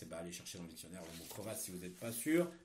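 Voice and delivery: ordinary delivery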